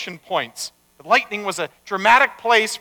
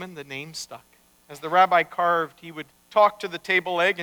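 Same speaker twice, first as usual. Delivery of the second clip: ordinary delivery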